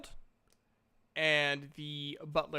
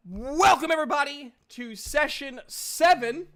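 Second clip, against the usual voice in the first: a touch of upbeat host, higher and louder; host welcome; 9 semitones higher, 8 dB louder than usual. Real, not acted. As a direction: open the show with a lift. Opening the game session.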